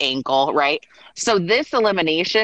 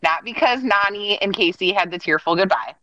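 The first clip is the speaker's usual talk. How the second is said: ordinary talk, nothing marked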